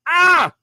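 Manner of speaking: loud high yelp; startled yelp. Retold or imitated. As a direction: jump awake with a cry. Startled on waking.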